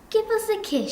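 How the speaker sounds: put-on high-pitched mum; high imitation of a mother's request; mum's voice. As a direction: mimic the mother asking, pitched well up. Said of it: "she said".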